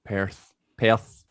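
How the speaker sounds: "Perth" said in the local Scottish way; shown pronunciation. Shown as a demonstration. Comparing hometown accents, careful local "Perth". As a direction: say "Perth" twice, trying out its vowel and R. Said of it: "I would say Perth".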